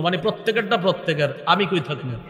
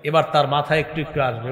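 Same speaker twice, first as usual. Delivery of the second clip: ordinary talk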